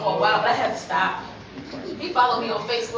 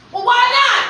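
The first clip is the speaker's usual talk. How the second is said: loud, high defensive snap; father getting worked up; indignant dad; 10 semitones higher, 9 dB louder than usual. Retold or imitated. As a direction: demand an answer. Dad angry, acted quarrel.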